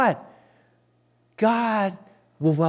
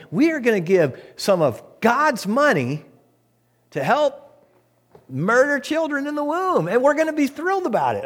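rising higher and faster in mock glee; mock gleeful pledge; 9 semitones higher than usual. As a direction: climb into a sarcastic thrilled voice. Mocking the church's stance.

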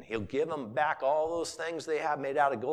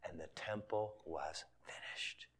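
hushed breathy whisper; 10 semitones lower, 15 dB quieter than usual. Real, not acted. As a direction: drop to a whisper on the ending. Quiet aside in sermon.

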